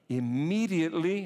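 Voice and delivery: immediately said high and loud, rising; stress on immediately. Shown as a demonstration. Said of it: "that's why I emphasize it".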